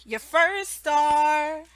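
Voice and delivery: "You get your first star" said very slowly; slow reward praise. Shown as a demonstration. Teacher giving a child a reward, slow. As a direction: hand out the reward slowly and clearly. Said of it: "I say".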